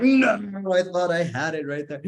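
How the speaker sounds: high, loud mock despair; comic dismay. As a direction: wail it like the rug was pulled. Dismay at being called back.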